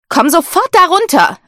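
high, loud horrified cry; horrified shout. A narrator's reading. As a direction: yell up in panic at the climbing daughter. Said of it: "rief Lady Bayford entsetzt".

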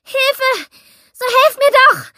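terrified woman screaming for help. A narrator's reading. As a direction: scream into the dark street. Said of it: "Ich schrie".

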